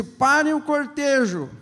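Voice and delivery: Jesus ordering the procession to halt; commanding halt. Acted. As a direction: stop the whole crowd with one command.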